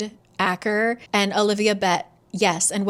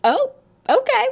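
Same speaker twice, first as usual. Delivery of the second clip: high-pitched, louder mock shock; startled, much higher little reply; taken aback; 6 dB louder than usual. Jovi reacting to a surprise booty call, taken aback.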